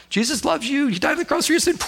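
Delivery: lightly voiced high friendly preacher; a hint of a street evangelist's cheer; mock preacher. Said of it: "Little time bomb".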